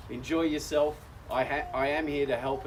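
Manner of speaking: ordinary talk, nothing marked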